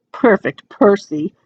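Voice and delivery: plain reading voice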